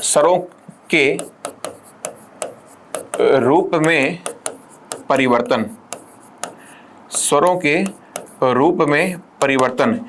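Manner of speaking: slow dictation. Shown as a demonstration. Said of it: "एक और परिभाषा सुनिए".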